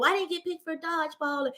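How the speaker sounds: put-on small, high, soft kid voice; sad child voice. Sadness telling why it is hurt, sad child voice. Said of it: "sadness was saying".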